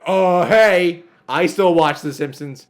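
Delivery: loud, proud mock fan; mock commenter. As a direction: boast it in a silly voice. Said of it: "people going".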